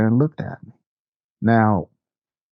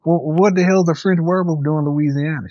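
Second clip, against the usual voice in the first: higher-pitched baffled question; retold incredulity; 8 semitones higher than usual. Employee questioning boss about a French werewolf, retold incredulity. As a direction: act out disbelief at an absurd claim.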